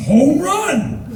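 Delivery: high, whooping exclamation; excited cheer. Retold or imitated. Tourist answers driver's baseball bonding, high excited cheer. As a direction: cheer it like a sports fan.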